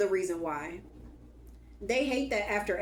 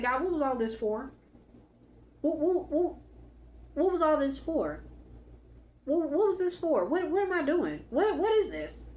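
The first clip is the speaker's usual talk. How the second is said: pitched-up, pleading questions with a half laugh; exasperated plea to God; 7 semitones higher than usual. Retold or imitated. Praying to God in frustration, a high exasperated plea. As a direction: throw your hands up and ask God why.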